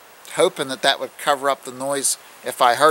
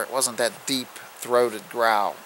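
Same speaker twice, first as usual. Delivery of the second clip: ordinary talk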